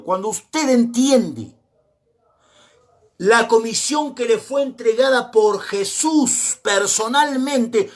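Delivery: stately preacher's cadence; slow preaching emphasis. Real, not acted. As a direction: drive each word home to the congregation.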